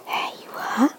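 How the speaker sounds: soft whisper. Real, not acted. Mother handing baby a spoonful, whispering.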